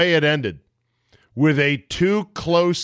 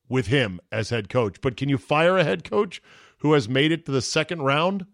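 ordinary talk, nothing marked

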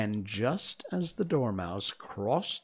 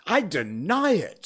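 high, loud denial; raised-voice protest; indignant March Hare; 8 semitones higher, 7 dB louder than usual. A narrator's reading. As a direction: deny it hotly. March Hare denies accusation.

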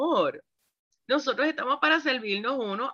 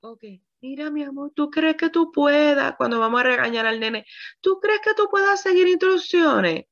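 condescending; 6 semitones higher than usual. Pretend. Mocking a spouse's condescending tone.

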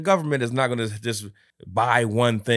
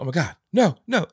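mock panic; 8 semitones higher than usual. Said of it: "She's like".

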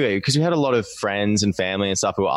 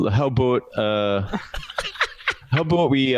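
higher-pitched mock Canadian, breaking into laughter; hammed-up Canadian "about"; bad Canadian accent; 3 semitones higher than usual. Shown as a demonstration. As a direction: attempt a Canadian accent on request. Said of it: "Can you do a Canadian, uh, accent".

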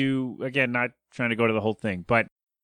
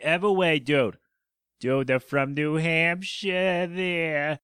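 hammed-up New Hampshire accent, drawn out; mock New England accent; 5 semitones higher, much slower than usual. Hosting his podcast and plugging the band Everway, in a mock New England accent. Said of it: "I know how to do the new English accent".

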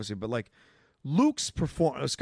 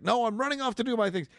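put-on eager teenage rush, pitched up; high, fast whiny kid voice; 9 semitones higher, faster than usual. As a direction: blurt it out like an impatient kid. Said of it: "The second kid who's like".

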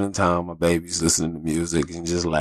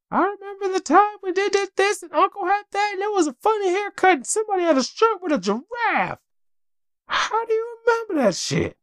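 put-on much higher, chirpy little-girl voice; imitating little daughter. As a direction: play a four-year-old rattling off a memory. Voicing four-year-old daughter remembering.

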